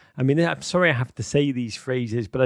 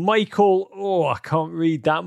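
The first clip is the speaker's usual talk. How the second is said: ordinary talk, nothing marked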